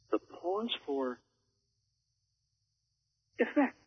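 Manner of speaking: long held pause, then effect lands high; dramatic pause before effect. Shown as a demonstration. Demonstrating a pause for effect to listeners. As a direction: hang in silence before effect. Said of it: "I just, I just did it twice".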